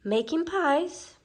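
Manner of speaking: a lightly voiced old crone, coaxing; old peddler woman. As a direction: sweet-talk like a scheming old hag. Old woman asks slyly.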